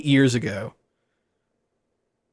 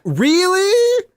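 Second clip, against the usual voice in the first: very high incredulous question; imitated amazed fan; 4 dB louder than usual. Starstruck player checking it's really him, high mock disbelief. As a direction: squeak it up in mock astonishment. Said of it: "And they're like".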